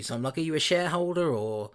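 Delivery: usual speaking voice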